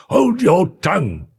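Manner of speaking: high, fierce reprimand; ferocious. A narrator's reading. Butler silencing the weeping maid, ferociously. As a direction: snap it fiercely, pitch jumping up. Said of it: "with a ferocity of accent".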